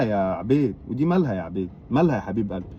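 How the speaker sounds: put-on low, soft coaxing to a crying child; soothing a crying nephew on the phone, soft and low; coaxing softly.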